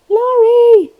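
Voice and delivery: high loud call across a bad line. Real, not acted. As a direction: shout her name to be heard. Calling out on bad connection.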